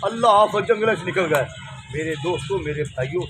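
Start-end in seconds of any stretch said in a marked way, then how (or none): 1.9-3.2 soft sermon aside. Acted. Soft lowered preacher aside.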